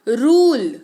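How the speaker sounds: रूल slowly, with a long "oo"; model pronunciation. Shown as a demonstration. Teacher modelling रूल slowly for students to copy. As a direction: stretch the "oo" in रूल. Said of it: "मैं जैसे उच्चारण कर रही हूँ ना".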